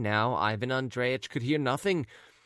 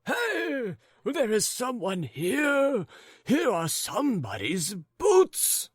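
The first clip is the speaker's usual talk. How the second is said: triumphant cry; 13 semitones higher, slower than usual. Acted. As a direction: shout out the find. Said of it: "cried the husband".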